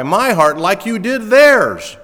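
my heart pushed loud, high and slow; preacher hammers home my heart; stress on my. Shown as a demonstration. Preacher drives Paul's plea to Philemon home, loud stress on my. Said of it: "capital M, capital Y".

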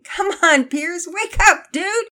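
loud, high, playful tickle-teasing shout; laughing wake-up call. A narrator's reading. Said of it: "began tickling Pearson's feet and laughing".

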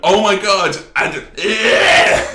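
high, loud, giddy exclaiming; mock-excited self after a gig. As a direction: squeal it out, buzzing. Retelling own adrenaline-fuelled cries.

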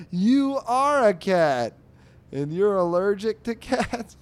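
loud, high, drawn-out incredulous outburst; amused exclamation. Real, not acted. Host reacting to Mooch's cat allergy, loud amused disbelief. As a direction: belt it out slowly, tickled by the absurdity. Amused outcry at comic's punchline.

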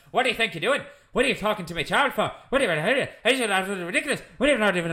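fast, garbled mock Irish babble; imitated Irish noise. Traveller confronting landowner about his child, fast mock Irish accent. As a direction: rattle it off in exaggerated Irish accent, words blurring. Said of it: "like, Irish noise".

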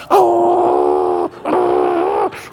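long, loud dog growl; imitated dog growl. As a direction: growl on and on like a dog that won't let go. Imitates dog hanging from towel.

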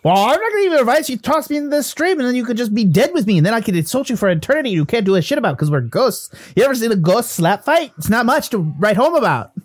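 quick, lilting Irish-Canadian ghost voice; combative ghost Willie. Acted. Ghost refusing advice to the adventurer, combative. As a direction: rattle off threats in a put-on mixed accent.

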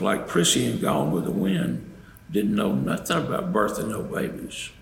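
usual speaking voice

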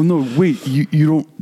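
ordinary talk, nothing marked

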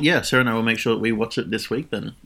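usual speaking voice

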